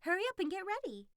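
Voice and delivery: high, hurried, thrilled fox voice; rushing Kuzma along with big news; excited fox. Acted. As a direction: fox urging him to hurry.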